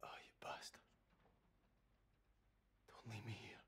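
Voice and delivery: soft, broken whispered grief; mourning softly over the body; grieving whisper. Acted. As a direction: whisper through tears. Grieving over dead friend.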